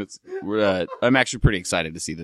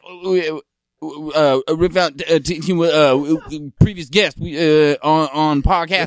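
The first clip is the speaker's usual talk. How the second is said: high, loud, stammering soul-singer hype; 6 semitones higher, 6 dB louder than usual. Pretend. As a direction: stammer it out like a hyped-up soul man. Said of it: "I went James Brown".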